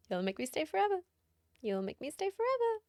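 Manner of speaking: mock-dramatic rising plea; rising sing-song. Repeated ever higher.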